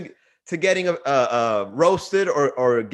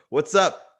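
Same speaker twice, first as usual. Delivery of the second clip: normal talking voice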